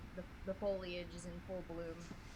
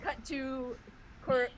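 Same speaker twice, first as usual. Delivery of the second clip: usual speaking voice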